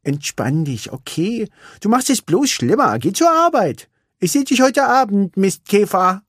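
high, teasing friend, playful; Vanellope's voice. Acted. Vanellope calms Ralf and sends him to work, teasing. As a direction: brush him off affectionately.

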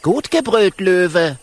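put-on high young dragon, cheering; cheeky compliment. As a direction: cheer the lion's roar. Kokosnuss compliments roar.

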